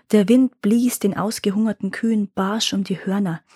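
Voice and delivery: ordinary delivery, nothing marked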